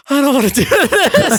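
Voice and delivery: laughing, embarrassed protest; laughing reluctance. Retold or imitated. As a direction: giggle out the dread. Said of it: "And I was like".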